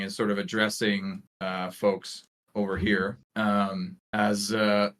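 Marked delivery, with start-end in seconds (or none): none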